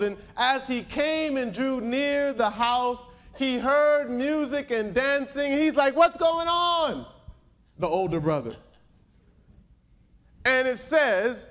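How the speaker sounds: high, emphatic pulpit storytelling; ringing sermon delivery, lively quotes; animated preaching. Acted. Animated preaching of older brother story.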